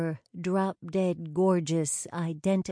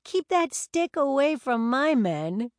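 laughing; 8 semitones higher, 4 dB louder than usual. A narrator's reading. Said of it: "Rachel laughed".

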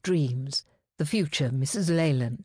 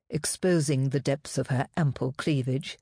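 ordinary delivery, nothing marked

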